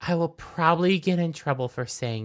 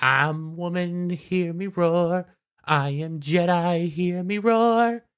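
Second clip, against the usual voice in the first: mock anthem; 3 semitones higher, slower than usual. Mocking show's empowerment line.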